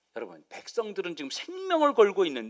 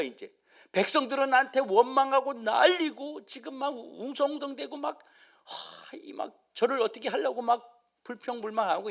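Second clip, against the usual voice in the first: imitating Moses' higher-pitched desperate plea; crying out to God; 4 semitones higher than usual. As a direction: plead with God, raised pitch, overwhelmed by complaints. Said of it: "하나님 앞에 부르짖는 겁니다". (모세를 흉내 내며 하나님께 부르짖듯)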